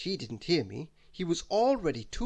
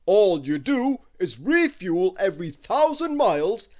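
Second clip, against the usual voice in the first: loud, slow booming giant, pitched high; a giant's big, slow welcome; Wizard Winterbottom welcoming; 6 semitones higher, 11 dB louder than usual. Acted. Giant wizard welcoming Christmas into his castle, loud and slow.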